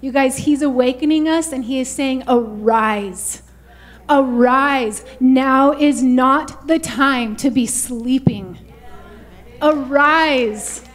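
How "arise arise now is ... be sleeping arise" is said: loud, emphatic wake-up summons; rousing call. Acted. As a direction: proclaim it like a preacher rousing sleepers, slowly. God's wake-up call, voiced by preacher.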